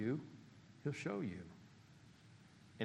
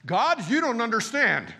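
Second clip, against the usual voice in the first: louder, slower mock whine; silly complaint; 8 semitones higher, 10 dB louder, slower than usual. Preacher mocking believers who tell God he doesn't understand. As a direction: ham up the complaint. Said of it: "how silly that sounds".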